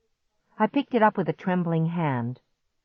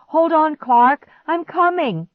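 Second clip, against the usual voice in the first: high, loud urgent reassurance; yell; 11 semitones higher, 8 dB louder than usual. A narrator's reading. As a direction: yell across the house. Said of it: "I yelled".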